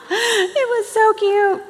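high, slow, laughing through the words. Real, not acted. Telling listeners about the Australian video, laughing. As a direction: say it still laughing with delight.

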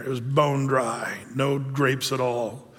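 quiet, disappointed close; deflated. Acted. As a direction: sound let down and empty. Voicing Micah's disappointment.

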